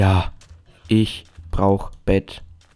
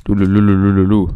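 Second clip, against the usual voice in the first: loud trilled "lululu" babble; silly babbling; 5 dB louder than usual. Real, not acted. Gamer all wound up, silly babbling. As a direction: babble nonsense syllables loudly.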